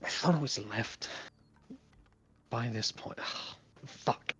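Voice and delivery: frustrated self-talk trailing into a whisper; muttering to himself. Acted. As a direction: mutter it under your breath as if lost. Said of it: "kinda muttering to himself".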